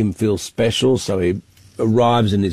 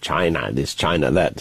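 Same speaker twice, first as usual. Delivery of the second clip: mock Trump saying China, a bit lower; lightly voiced Trump, leaning on China; Donald Trump impression; 8 semitones lower, 4 dB quieter than usual. Correspondent briefly mimics Trump saying China, a lightly lowered mock impression. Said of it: "sorry about my bad impersonation".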